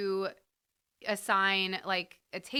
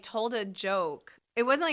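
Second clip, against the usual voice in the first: ordinary talk, nothing marked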